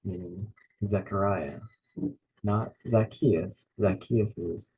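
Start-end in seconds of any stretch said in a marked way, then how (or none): none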